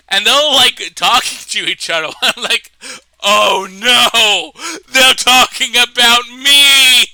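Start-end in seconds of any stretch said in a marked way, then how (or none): 3.2-7.0 loud comic wail of dread; hammed-up cry of dismay; mock panicked shout. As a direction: shout it in mock horror, drawing out the last word. Acts out own panic, retold.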